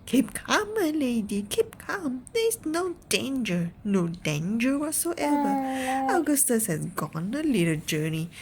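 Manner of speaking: Wonka calming a mother. Acted. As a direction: coax her down, bright and eager. Narrator voicing Wonka calming.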